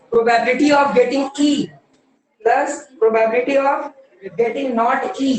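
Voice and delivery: slow, measured read-out of the formula; teacher reading the question to the class. Shown as a demonstration. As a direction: read each term carefully.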